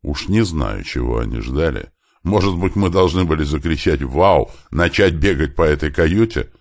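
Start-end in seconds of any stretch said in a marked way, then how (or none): none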